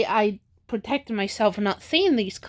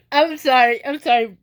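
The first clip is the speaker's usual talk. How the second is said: laughing through tears; 3 dB louder than usual. Real, not acted. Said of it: "I'm crying".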